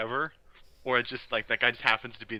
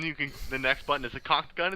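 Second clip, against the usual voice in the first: ordinary delivery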